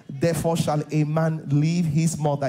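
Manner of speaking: ordinary talk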